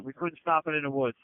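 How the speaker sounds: ordinary talk, nothing marked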